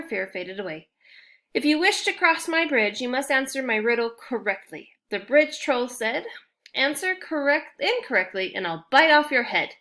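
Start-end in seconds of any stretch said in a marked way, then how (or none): 1.5-9.7 lightly voiced troll, higher and louder; troll threat. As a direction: menace them with the riddle rule. Troll sets his riddle terms.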